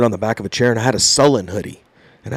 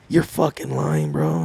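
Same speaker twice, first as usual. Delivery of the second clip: disbelieving sergeant; 10 semitones lower, 8 dB quieter, slower than usual. Retold or imitated.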